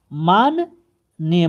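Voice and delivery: माननीय very slowly, stretched as maan-neey; slow model form. Shown as a demonstration. Teacher shows the word in use, slow careful demonstration. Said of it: "शब्द का प्रयोग किया जाता".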